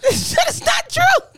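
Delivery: high, loud laughing denial; laughing protest. Real, not acted.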